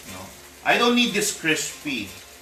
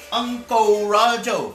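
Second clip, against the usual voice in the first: "Uncle Roger" said high and slow, as "Ungkoh Rajoh" in a mock Chinese-Malaysian accent; 7 semitones higher, 4 dB louder, slower than usual. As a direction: stretch "Uncle Roger" in a hammed-up Uncle Roger imitation. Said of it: "That's how he talks".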